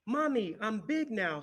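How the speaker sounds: high, loud call to mother; excited cry. A narrator's reading. Little hippo calls to his mother, crying out with joy. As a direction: burst out proudly to Mommy. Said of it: "he cried".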